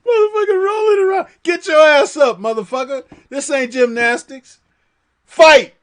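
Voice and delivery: high, loud, fast yelling half in tears of laughter; laughing shout. Real, not acted. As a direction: yell at him through laughter. Yelling at fighter while laughing.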